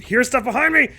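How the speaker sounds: high loud panicked cry. Real, not acted. Panic, something behind.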